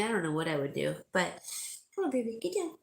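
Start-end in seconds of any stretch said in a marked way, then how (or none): 1.9-2.7 high, gentle coaxing command to a pet. Real, not acted. To her dog on her lap, high coaxing baby talk.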